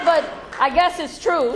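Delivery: normal talking voice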